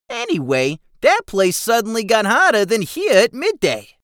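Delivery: very high, loud goblin excuse; pleading goblin. Acted. Keswick defends himself to Jigsaw, high and loud. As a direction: whine it out like a caught goblin.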